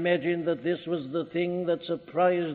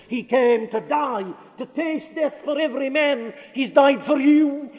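rising preaching shout; 4 semitones higher, 6 dB louder than usual. Real, not acted. Preacher crying out to the congregation, building to a shout. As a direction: build from raised to near shouting, higher. Preacher's climax on Christ dying.

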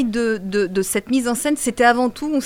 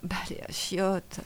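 weak whispered sick voice; 3 semitones lower, 9 dB quieter, slower than usual. Pretend. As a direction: breathe it out like you're ill.

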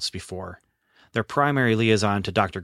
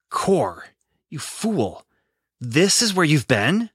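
exasperated outburst; 6 semitones higher, 3 dB louder, much slower than usual. A narrator's reading. Brother found in a cell.

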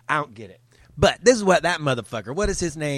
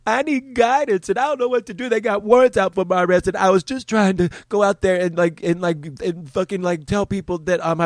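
higher whiny voice; mock crying man; 6 semitones higher, 4 dB louder than usual. Mocking the weeping man's video, high and whiny. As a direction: whimper it like a crybaby. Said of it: "It's about".

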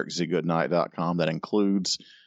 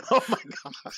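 normal talking voice

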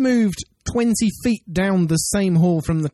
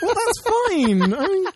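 much higher, slow giggly words; laughing; 14 semitones higher, slower than usual. Real, not acted. Co-host reassuring through laughter, giggling.

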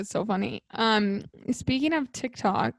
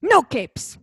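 Edna Mode impression; 4 dB louder than usual. Cohost adding another Edna Mode line for the host, bossy. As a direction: snap the order like a bossy designer. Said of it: "Or, like".

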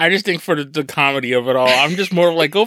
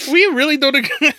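laughing; 11 semitones higher than usual. Real, not acted. Laughing after friend's shocking opinion.

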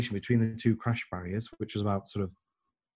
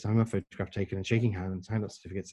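normal talking voice

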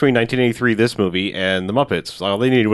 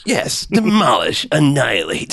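drawn-out, higher-pitched parroting; mock henchman echo; 4 semitones higher, slower than usual. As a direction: chime in slowly like an eager yes-man. Henchmen echoing boss with synonyms.

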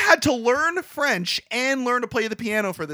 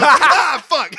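laughing mock curse; 6 semitones higher, 8 dB louder than usual. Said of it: "He's like".

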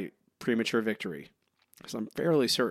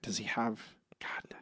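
whispered to himself, searching; whispering; 11 dB quieter than usual. Real, not acted. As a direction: whisper while trying to remember. Straining to recall answer.

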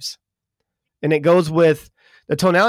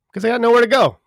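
joking tease; 7 semitones higher, 3 dB louder, faster than usual. Acted. Joking about slow southerners.

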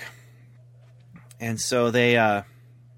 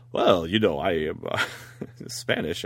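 low, halting Spanish gentleman voice; Ricardo Montalban; 5 semitones lower than usual. Acted. Spanish actor answering the accusers, deep hammed-up impression. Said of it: "And Ricardo Montalban".

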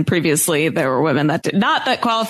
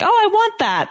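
excited; 13 semitones higher than usual. Retold or imitated. Retold excited hope.

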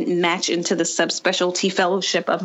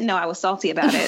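ordinary talk

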